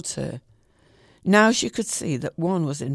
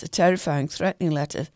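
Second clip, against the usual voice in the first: plain reading voice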